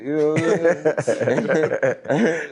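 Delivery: slow, high, laughing through the words. Real, not acted. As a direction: let the laugh stretch the line out. Laughing at his own joke.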